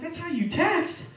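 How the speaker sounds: high, drawn-out disbelief; slow, astonished question; incredulous echo. Acted. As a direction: throw it back slowly, pitch climbing high. He echoes her in disbelief.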